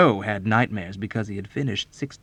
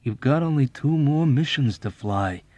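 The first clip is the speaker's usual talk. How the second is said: reasoning; 6 semitones higher, 4 dB louder than usual. A narrator's reading. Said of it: "reasoned with him in a low voice".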